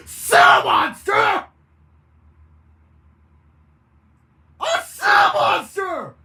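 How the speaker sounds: horrified shout. Acted. Narrator screams that she turns into a sea monster. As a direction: scream the words out in horror.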